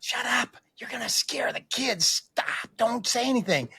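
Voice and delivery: high, whispery scolding as the wife; urgent hushing. Retold or imitated. Wife hushing him, acted.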